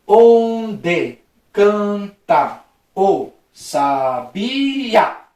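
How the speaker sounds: very slow, every syllable of "Onde canta o sabiá" stretched apart; slow syllable-splitting demonstration. Shown as a demonstration. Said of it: "vamos separar as sílabas normal".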